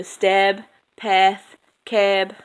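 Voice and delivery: "stab, path, cab" as steb, peth, keb. Shown as a demonstration. Listing examples of the sound, "stab, path, cab" with eh. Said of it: "It becomes an eh".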